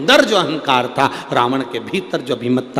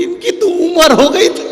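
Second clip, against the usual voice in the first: sharply higher, louder, mocking wife's voice; wife's offhand remark; 14 semitones higher, 5 dB louder than usual. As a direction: toss it off, voice jumping high. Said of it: "इतने में सेठानी बोली". (पत्नी की ऊँची, तानेभरी आवाज़)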